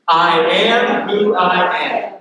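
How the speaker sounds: drawn out, each word spaced; slow congregational chant; slow unison recital. Shown as a demonstration. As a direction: lead the room slowly through it. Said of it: "Say that with me".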